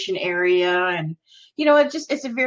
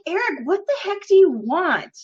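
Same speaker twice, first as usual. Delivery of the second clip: high-pitched exasperated retort; mock annoyance at a husband acting up; 8 semitones higher, 3 dB louder than usual. Wife retelling her reply to embarrassing husband, exasperated.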